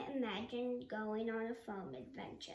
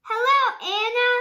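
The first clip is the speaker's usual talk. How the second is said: high, loud sing-song class greeting; chiming class; 11 semitones higher, 19 dB louder than usual. Acted. Whole class greeting the new girl, loud sing-song chime. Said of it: "chimed the class".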